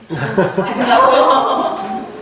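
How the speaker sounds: slow, amused question; laughing. Real, not acted. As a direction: tease them with a laugh. (웃으며 천천히 묻기)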